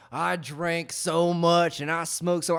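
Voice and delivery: slow, loud overdone boast; mock drunk old man. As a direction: drag it out like a show-off drunk. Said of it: "He goes".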